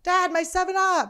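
put-on high, loud, slow kid's whine; a small kid crying to her dad over a lost soda; childish wail. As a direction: whine like a four-year-old losing her soda. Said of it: "Another traumatizing moment".

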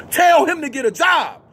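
high, hard shouted command; street preacher shout. Real, not acted. Preacher shouting at the mother about her grown son. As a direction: bark it at the mother.

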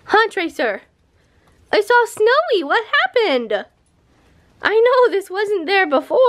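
high, bright pet-talk voice; sing-song voice for the dog. Real, not acted. Talking to the dog.

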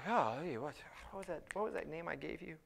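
hushed, halting mumble; mock forgetfulness. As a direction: grope for a forgotten name under your breath. Mimics Jesus having a memory lapse.

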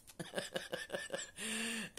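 high, soft laugh; giggling at her own fangirl moment; giggle. Real, not acted. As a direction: laugh at herself. Laugh after retold gush.

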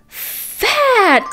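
normal talking voice

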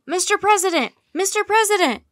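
high, loud shout from a cheering crowd. A narrator's reading. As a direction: shout to get the great man's attention. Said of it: "Jack heard someone shout".

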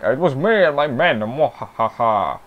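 hammy villain boast ending in a gloating laugh; lightly voiced cartoon villain; evil Duke voice. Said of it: "That's my evil Duke voice".

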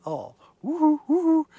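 high mock hoots; mock crazy hoot. Preacher mocking Pilate's view of a madman.

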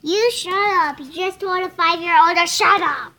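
loud, scolding comeback; indignant retort. Real, not acted. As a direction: snap back loudly, outraged at being told off. Siblings arguing, snapping back at insult.

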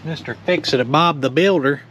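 ordinary delivery

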